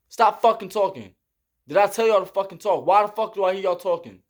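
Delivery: fast, clipped mock scolding; imitating angry drill sergeant. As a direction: chew out the recruits like a drill sergeant. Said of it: "That's how he talk".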